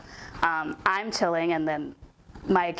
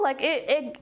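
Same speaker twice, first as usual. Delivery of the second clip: usual speaking voice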